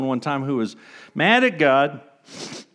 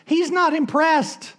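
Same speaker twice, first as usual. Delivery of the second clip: dry punchline; 13 semitones higher, slower than usual. Real, not acted. Punchline said slow and high.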